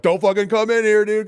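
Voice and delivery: high, loud panicked warning; urgent warning shout. Retold or imitated. Man warning friends out of a flooded bathroom, urgent shout. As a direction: shout to stop them at the door. Calls out to friends entering room.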